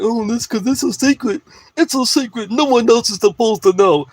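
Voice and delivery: mock them as smug gatekeepers; mocking secretive voice. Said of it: "Then they gotta be like".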